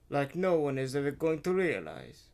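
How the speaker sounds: usual speaking voice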